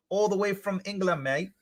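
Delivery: badly faked English accent; terrible English accent. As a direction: put on a bad London accent. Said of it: "that is a terrible accent".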